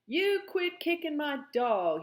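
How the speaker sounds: put-on high, loud child's angry yell; fierce yell. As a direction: yell it fiercely like a small boy. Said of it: "he yelled fiercely".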